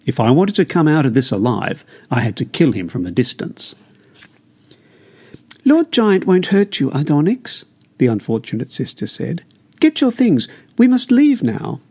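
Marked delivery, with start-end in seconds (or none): 5.6-11.8 mother's voice, higher, urging her son; anxious mother pleading with her son; urgent mother. Acted. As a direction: press him to leave now, higher pitch. Mother urges her son to flee.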